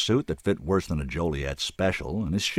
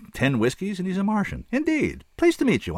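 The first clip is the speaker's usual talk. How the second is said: much higher mocking inner voice; sarcastic disbelief; 13 semitones higher than usual. A narrator's reading. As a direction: roll your eyes at a drunk claiming to be a Martian. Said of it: "I thought".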